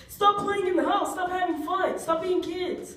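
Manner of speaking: high mock yell of a grumpy uncle. Comic imitating an uncle yelling at kids, high mock yell. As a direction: bark it like a cranky uncle.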